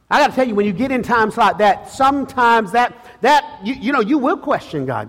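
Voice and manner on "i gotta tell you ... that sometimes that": normal talking voice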